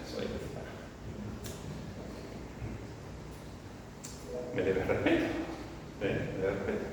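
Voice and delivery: soft, low demand for respect; boastful elder. Acted. Imagined elder demanding respect from members, self-important. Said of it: "le encanta presumir de lo que no deben de presumir".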